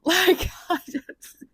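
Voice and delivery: high, slow, voice breaking near tears; almost crying, can't finish the thought; teary, cracking voice; choked up. Real, not acted. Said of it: "I'm tearing up almost".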